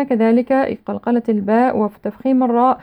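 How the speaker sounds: usual speaking voice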